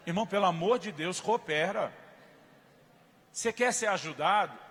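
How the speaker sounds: loud, high, forceful preaching; fiery preaching. Acted. Pastor to congregation, loud fiery preaching.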